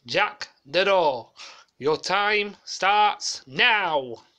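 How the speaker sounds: booming stretched-out countdown call; Leslie Grantham catchphrase. Retold or imitated. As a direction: call the start like a game show host, drawn out. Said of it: "doing a copycat of Leslie Grantham on Fort Bayard".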